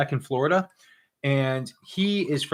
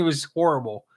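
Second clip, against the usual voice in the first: ordinary talk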